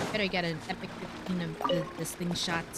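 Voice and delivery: ordinary talk, nothing marked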